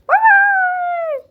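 very high, loud squeaky bye-bye; squeaky goodbye. Pretend. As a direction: squeak it like a cartoon. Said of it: "squeaky goodbye voice".

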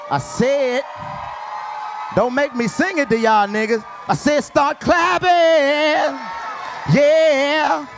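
loud, slow hype-man intro; shouted stage introduction; hype announcer. Acted. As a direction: pump the crowd up and bring him on. Hype host introducing next comic.

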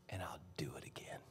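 breathy whisper; whispered promise. Retold or imitated. God whispering his promise to Jesus. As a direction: lean in and whisper it.

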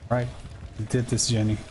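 ordinary talk

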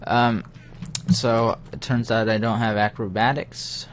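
ordinary talk, nothing marked

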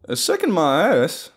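high, loud, indignant protest; high-pitched griping; loud complaint. A narrator's reading. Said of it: "Dex complained".

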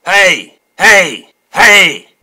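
very loud, separate shouts. Acted. Weasel at the window to the newsies, shouting. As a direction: bellow to get the crowd's attention. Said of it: "Weasel shouted from the window".

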